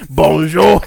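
loud caricature French greeting; French accent. Shown as a demonstration. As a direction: boom it like a stage Frenchman. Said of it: "Lou, do a French accent".

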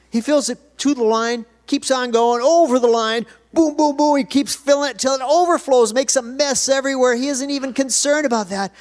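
loud, high, surging pulpit delivery; excited preaching. Real, not acted. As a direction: build it up like an unstoppable flood.

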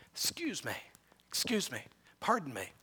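almost voiceless whisper; woman pushing through the crowd, whispering excuses; whispered apologies. Acted.

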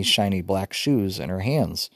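usual speaking voice

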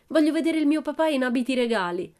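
high-pitched eager demand; thrilled child demanding a show; excited squealing. A narrator's reading. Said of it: "strillò Pippi".

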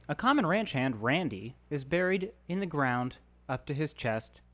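measured script reading of the scene; reading stage directions to the cast, measured. Acted. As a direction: set the scene like a screenplay narrator. Reads sketch stage directions.

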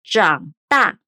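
长大 as zhǎng dà, falling-rising then falling tone; 长大 em mandarim, tons claros; Mandarin zhǎng dà. Shown as a demonstration. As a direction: say 长大 slowly with clear Mandarin tones. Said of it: "Vamos lá".

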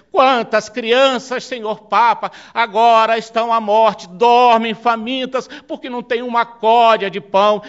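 fervent oratorical lament; impassioned plea. Acted. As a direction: plead with mounting force, drawing out key words. Acted indignant plea to the Pope.

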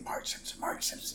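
hushed, repeated whisper; whispered crowd murmur. Retold or imitated. Imitating the crowd's murmured prompting.

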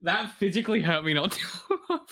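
usual speaking voice

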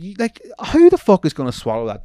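squeaky high incredulous outburst, then loud; can't believe the story, so squeal it. Real, not acted. Host reacting to an unbelievable dog story, incredulous squeal.

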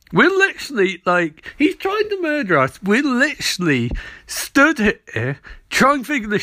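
high, incredulous whining rant; exasperated high rant. Retold or imitated. Ronnie and Martin arguing with Barry, a high exasperated rant. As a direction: plead in disbelief at his refusal. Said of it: "And they're like".

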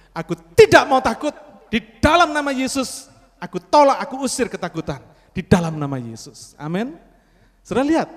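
loud, high fervent prayer and declaration; preacher leading the crowd in loud prayer; full-force preaching. Acted. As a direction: rebuke fear in Jesus' name, with fire.

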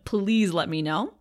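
ordinary talk

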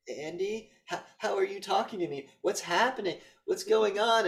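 high, stammered questions; mock panicked confusion. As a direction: imitate a rattled woman on the phone. Acts out panicked woman on phone.

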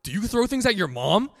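high, loud scolding; scolding teacher. Retold or imitated. Teacher shouting at a student for throwing things. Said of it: "She was like".

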